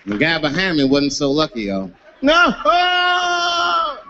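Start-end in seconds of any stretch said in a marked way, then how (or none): 2.2-4.0 high, long comic wail of "no"; loud mock victim's scream; horrified scream. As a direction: howl in hammed-up horror. Retold victim hit in the face.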